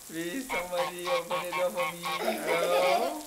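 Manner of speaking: loud, high calling through a door. Acted. Father calling at the locked door for someone to open, loud and laughing. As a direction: call out to whoever is inside, laughing.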